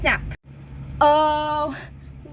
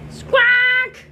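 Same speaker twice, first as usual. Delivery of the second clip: parrot squawk; 7 semitones higher, 6 dB louder than usual. Acted.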